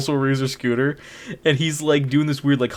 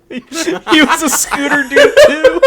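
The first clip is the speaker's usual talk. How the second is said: laughing; 13 semitones higher, 10 dB louder, slower than usual. Real, not acted. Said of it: "laughing at scooter detail".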